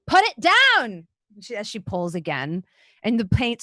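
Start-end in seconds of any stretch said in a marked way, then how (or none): 0.1-1.1 loud, high yell as she tugs; angry shout. A narrator's reading. GM voicing the flannel girl tugging the bucket, angry. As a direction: shout it while wrestling the bucket. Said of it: "pulling at it angrily".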